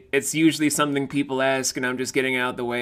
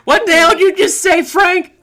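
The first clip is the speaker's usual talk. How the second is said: high shocked yell; shocked shout; 10 dB louder than usual. Retold or imitated. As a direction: blurt it out loud and high. Acted outburst at Frank.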